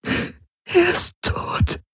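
slow, breathy high whisper, nearly gone; sobbed whisper. Acted. Ylana breaks down against Garlan, whispering. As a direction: let the repeat collapse into a whisper. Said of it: "schluchzte Ylana".

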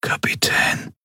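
hauchdünnes, tonloses Flüstern; dünne Stimme. Acted. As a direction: hauch es kraftlos heraus. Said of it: "Die Stimme klang dünn wie ein Lufthauch". (dünn wie ein Lufthauch)

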